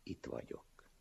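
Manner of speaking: soft, hushed, near-whispered reassurance; gentle. A narrator's reading. Master answers the wretch in the ditch, gently. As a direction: say it very softly and low. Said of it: "szelíden szólt".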